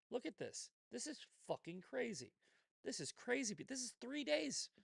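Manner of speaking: rising up high in disbelief; amazed. Real, not acted. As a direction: marvel at how crazy it is. Amazed at hate messages.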